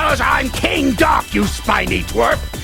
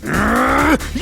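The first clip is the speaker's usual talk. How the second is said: frustrated villain "grr"; seething throaty snarl; angry growl. Acted. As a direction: growl with fury at the betrayal. Villain angry at his robot.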